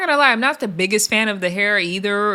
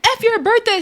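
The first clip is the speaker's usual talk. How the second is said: mock angry taunt; 12 semitones higher than usual. Big Lex taunting Tavy Baby on her birthday, high mock snarl. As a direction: throw the taunt like you're about to swing. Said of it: "she's like".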